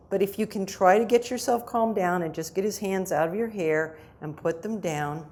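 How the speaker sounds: normal talking voice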